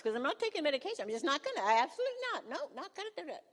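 loud, high, hammed-up tantrum; mock outraged refusal. Pastor's wife refusing medication, mock outraged refusal. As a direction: dig in with escalating, high-pitched protest.

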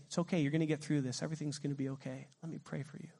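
soft, low, quick comforting; gently reassuring. Acted. Friend comforting someone who called for help, gently reassuring.

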